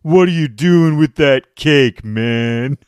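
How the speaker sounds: slow, loud mocking bro drawl; mocking dude voice. Smug guy taunting a man holding a cake, loud and slow. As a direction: needle him like a smug jerk. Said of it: "He's like".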